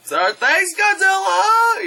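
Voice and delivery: high, drawn-out call; hammed-up crowd farewell; mock grateful shout. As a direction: call out thanks to a departing hero. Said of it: "And you're just like".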